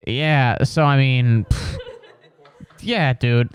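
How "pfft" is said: usual speaking voice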